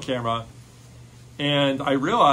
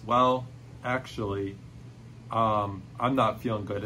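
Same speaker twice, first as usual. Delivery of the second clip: put-on sick girl; 5 semitones lower, 5 dB quieter than usual.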